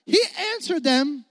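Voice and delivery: ordinary talk, nothing marked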